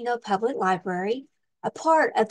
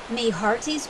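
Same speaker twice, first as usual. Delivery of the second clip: lively storybook read-aloud with big swings; reading to children; slower than usual. Acted. Librarian reading a pirate picture book to children, lively storytime voice. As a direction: read it like storytime for little kids.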